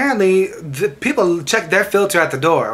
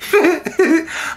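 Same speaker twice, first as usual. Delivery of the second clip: laugh; 11 semitones higher than usual. Real, not acted. Laughing at own story.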